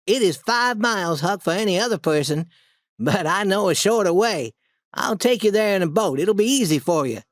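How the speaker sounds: lightly voiced boy, confident plan; reassuring Tom. A narrator's reading.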